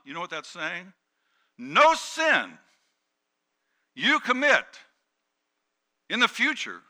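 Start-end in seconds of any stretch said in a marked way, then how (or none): 1.6-6.8 high, loud, phrase-by-phrase proclamation; emphatic, raised-voice sermon punch; preaching at full force. Real, not acted. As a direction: hammer each phrase with a pause after it.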